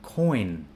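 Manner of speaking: coin with its N complete; final model coin, falling; full final N. Shown as a demonstration. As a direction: say coin carefully through to N. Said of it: "cut the N out".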